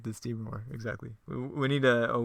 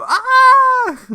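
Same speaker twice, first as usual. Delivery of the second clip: high, loud cartoon scream; 17 dB louder than usual. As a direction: shriek as you're yanked away. Henchman dragged away.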